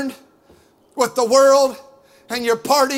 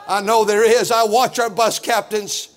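ordinary delivery

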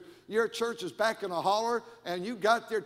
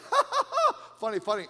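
mock laughter; 3 semitones higher, 6 dB louder than usual. Greeter laughing at the pastor's joke, imitated with exaggerated laughter. Acts out the listeners' laughing reply.

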